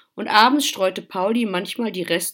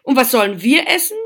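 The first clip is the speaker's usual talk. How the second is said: quick, loud, higher-pitched reproach; scolding; 6 semitones higher, 10 dB louder, faster than usual. A narrator's reading. Brother worried about his food, scolding. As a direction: fire off an indignant question. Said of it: "schimpfte Max".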